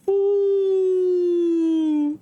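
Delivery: long, steady high-pitched "ooh"; high ringing hum. Acted. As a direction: imitate crystals ringing on one held note. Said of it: "you hear".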